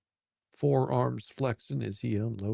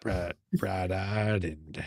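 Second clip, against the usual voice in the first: Liam drawl; slower than usual. Acted. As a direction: draw it out lazily. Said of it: "he drawled".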